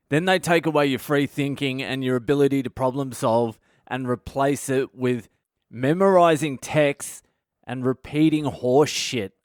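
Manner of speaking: ordinary delivery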